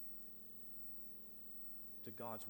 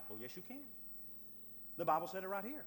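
usual speaking voice

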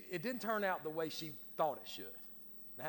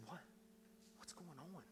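afraid, bewildered; 21 dB quieter than usual. Acted. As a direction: shrink back and ask it almost inaudibly. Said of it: "then he got afraid".

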